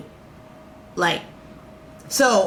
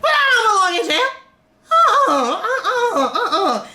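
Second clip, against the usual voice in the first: indignant protest; 13 semitones higher than usual. Real, not acted. Telling viewers she doesn't belong in jail, high indignant protest.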